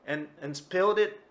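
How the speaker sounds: can't believe the cat did it again; exasperated disbelief. Acted. Tora-san's exasperated disbelief.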